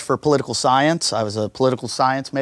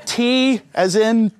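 higher, halting mock nervousness; nervous, as if tested; 8 semitones higher, much slower than usual. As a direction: spell it haltingly, like a flustered test-taker. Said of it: "So I get all nervous".

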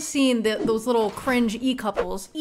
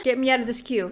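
normal talking voice

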